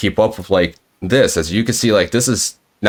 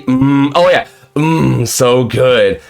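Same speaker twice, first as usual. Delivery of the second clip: mock savoring; much slower than usual. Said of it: "it was supposed to say".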